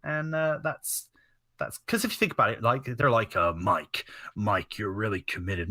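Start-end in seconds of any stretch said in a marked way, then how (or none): 3.5-5.7 low, slow, a light Triple H growl; a lightly voiced boss; Triple H impression. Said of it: "That is kind of a good Triple H".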